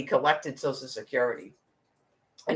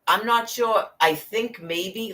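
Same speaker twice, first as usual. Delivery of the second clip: higher, hesitant, slowing into maybe; unsure hedging; 6 semitones higher, slower than usual. Shown as a demonstration. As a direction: sound politely unsure, trailing off. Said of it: "learn to talk like that".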